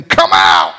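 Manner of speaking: Jesus casts the demon out, low and loud; commanding rebuke. Acted. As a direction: order it out with authority.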